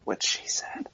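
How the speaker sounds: softly whispered punchline. Real, not acted. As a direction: slip the joke in under the breath. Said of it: "whispered punchline joke".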